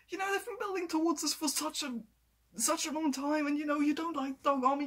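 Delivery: excited, pitched well up; real excitement. Real, not acted. Fan on characters finally beating the villains, high excited voice. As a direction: let the pitch ride high with built-up excitement. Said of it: "I'm getting so excited about this".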